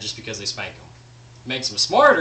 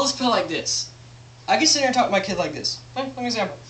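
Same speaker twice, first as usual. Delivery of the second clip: normal talking voice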